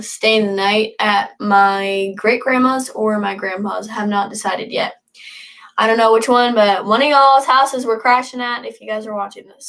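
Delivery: normal talking voice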